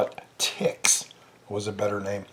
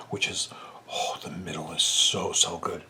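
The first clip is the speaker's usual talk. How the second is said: hushed whisper; 6 semitones lower, 9 dB quieter than usual. Real, not acted. Savoring, hushed.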